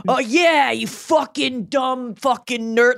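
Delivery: slow, mock-harsh insult; imitating a friend's harsh comeback; digging in. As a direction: dig in on each swear word. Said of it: "Like, he digs in".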